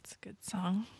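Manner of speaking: soft unvoiced whisper. Real, not acted. Whispering a side remark to her co-host. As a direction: whisper it as an aside.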